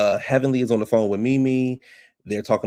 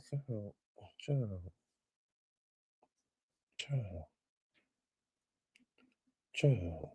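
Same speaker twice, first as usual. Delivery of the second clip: breathy disbelief; 15 dB quieter, much slower than usual. Real, not acted. Reacting in disbelief to the news.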